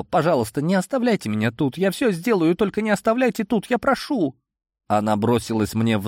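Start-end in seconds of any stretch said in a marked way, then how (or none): 0.0-4.3 high, fast, rising plea; desperate begging. A narrator's reading. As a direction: burst into frantic begging. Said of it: "её словно прорвало".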